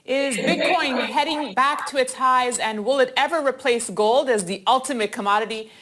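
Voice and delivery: high-pitched TV anchor delivery. Real, not acted. Anchor opens the Bitcoin segment to camera in a high anchor voice. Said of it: "your voice was an octave higher".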